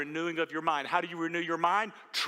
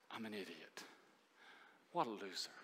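much softer, lower, defeated murmur; self-talk; 8 semitones lower, 16 dB quieter than usual. Retold or imitated. Pastor voicing the inner voice that tells us we're losers, hushed. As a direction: say it like the lie you whisper to yourself. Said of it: "we tell ourselves".

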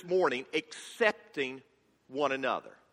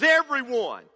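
higher, louder single curt word; Shrek's blunt answer; 7 semitones higher, 6 dB louder than usual. Retold or imitated. Pastor voicing Shrek answering who he keeps out. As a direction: shut the question down. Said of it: "Shrek finally says".

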